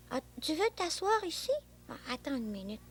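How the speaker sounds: ordinary delivery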